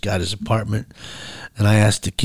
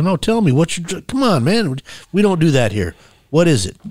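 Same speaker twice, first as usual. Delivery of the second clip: higher-pitched, insistent coaxing; 7 semitones higher than usual. Retold or imitated. Church man presses the kid to confess, coaxing. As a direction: press him to open up, pitch raised.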